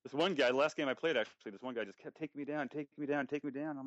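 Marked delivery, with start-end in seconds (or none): none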